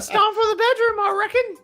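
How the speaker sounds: put-on very high squeaky Aussie Sheila accent; high falsetto Australian woman accent; mock Aussie Sheila. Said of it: "give us your best Aussie Sheila accent".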